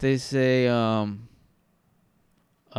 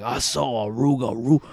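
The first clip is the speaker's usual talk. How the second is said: Cajun witness. Retold or imitated. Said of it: "hearing people talk about it".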